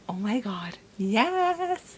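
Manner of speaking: soft start, then a high burst on yes; excited yes. Retold or imitated. Vlogger reacting to merging timelines, excited. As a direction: let the yes leap up. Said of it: "I'm like".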